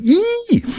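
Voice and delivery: a very high mock squeal. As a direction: squeak it out like a startled woman. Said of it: "start going".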